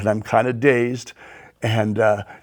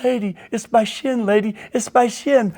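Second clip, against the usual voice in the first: exasperated protest; 9 semitones higher than usual. Retold or imitated. Hurt biker protests to the ladies.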